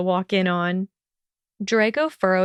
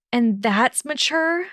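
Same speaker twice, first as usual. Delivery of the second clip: hissed; 5 semitones higher, slower than usual. A narrator's reading. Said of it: "she hissed".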